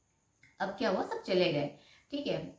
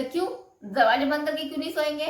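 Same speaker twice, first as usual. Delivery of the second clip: mock indignant, higher, louder and fast; husband annoyed she won't close the door, mock angry; imitating angry husband; 8 semitones higher, 8 dB louder, faster than usual. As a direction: throw the question back at her. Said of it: "एकदम गुस्सा हो गया था वो".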